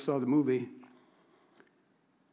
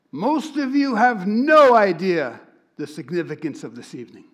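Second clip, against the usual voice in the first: louder, lifted opening line that falls away at the end; British Hitchcock-like rabbi; 4 semitones higher, 9 dB louder than usual. Retold or imitated. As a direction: boom it like a stern British guest speaker. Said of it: "This guy's British, and he looks like Alfred Hitchcock. He does, and he sounds like him".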